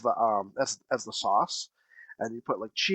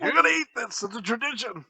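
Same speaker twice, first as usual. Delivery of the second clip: mock-stern dad, pitched up; insistent dad; 4 semitones higher than usual. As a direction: lightly play a dad laying down the law. Acts dad insisting on tradition.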